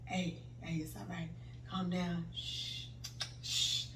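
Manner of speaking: very soft, low soothing murmur; comforting husband. Retold or imitated. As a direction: hush gently, imitating a husband calming his wife. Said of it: "He trying to, like, comfort me".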